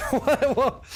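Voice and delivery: high, laughing "what"; baffled laugh. Real, not acted. Streamer baffled by what a viewer is doing, laughing. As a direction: laugh through it in disbelief.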